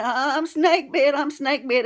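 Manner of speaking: a scared granny pleading with her husband; frantic cry. Retold or imitated. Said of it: "she's saying".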